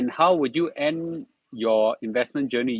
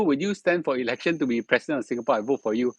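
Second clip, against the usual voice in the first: normal talking voice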